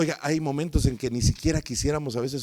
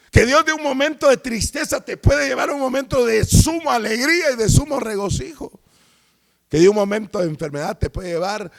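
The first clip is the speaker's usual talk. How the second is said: climbing higher and louder, full preaching force; impassioned preaching; 6 semitones higher, 5 dB louder than usual. Acted. Pastor to congregation about God's purpose, rising fervent preaching.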